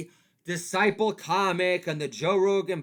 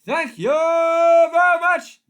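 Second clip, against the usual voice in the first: high, loud and slow; over-the-top comic sign-off; 13 semitones higher, 14 dB louder, slower than usual. Acted. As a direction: belt it like a lounge comic.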